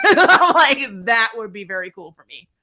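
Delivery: laughing through the words, loud at first. Real, not acted. Streamer laughing at a fan theory, laughing loudly. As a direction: giggle out your delight at the idea.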